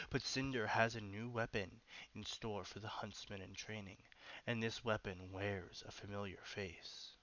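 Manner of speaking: whispered trailer read; dramatic whisper. Acted. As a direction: hush it like a movie teaser. Reads teaser in dramatic whisper.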